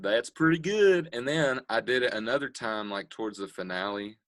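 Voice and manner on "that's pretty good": higher, slow drawn-out quote. Retold or imitated. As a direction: imitate a judge's slow verdict. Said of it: "Well, he said".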